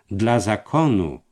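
ironic. A narrator's reading. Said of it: "ironicznie począł się uśmiechać".

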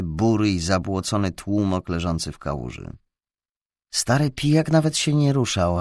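usual speaking voice

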